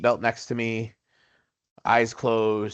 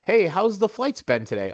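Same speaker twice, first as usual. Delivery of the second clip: high, bright-eyed cheerful hello; overly chipper friendly greeting; 9 semitones higher than usual. Retold or imitated. Chatting up the stewardess, nervous but chirpy. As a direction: play the eager friendly passenger masking nerves. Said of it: "me being friendly Joe, nervous as all get up".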